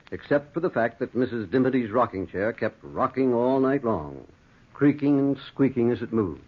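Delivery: measured, low storytelling voice; calm fireside narration; story narration. A narrator's reading. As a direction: tell it like a spooky radio tale.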